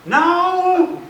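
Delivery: high, loud imitated yell; upset daughter. Upset daughter refusing to talk to dad, high angry yell. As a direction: shut dad out with a yelled refusal. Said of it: "I can tell she's upset".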